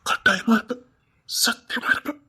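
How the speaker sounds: intimate whispered vow; whispered promise. Acted. Husband vowing to his wife he will stay, whispered. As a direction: breathe the promise close to her.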